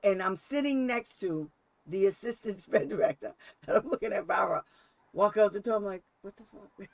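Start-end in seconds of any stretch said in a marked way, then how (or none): 6.2-6.9 very soft, under-the-breath disbelief; hushed aside. Retold or imitated. Sitting by the director as Barbara walks out, hushed disbelief. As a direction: say it almost silently, as a retold aside.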